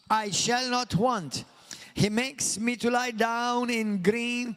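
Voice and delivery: slow, declaimed psalm reading; loud scripture reading. Shown as a demonstration. Pastor leading everyone in reading the psalm aloud, slow declamation. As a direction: proclaim each phrase aloud, stretching the key words. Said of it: "Let's read it and speak it loud".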